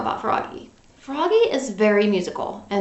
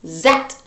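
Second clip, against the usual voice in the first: zoop sound effect; 6 semitones lower than usual. A narrator's reading.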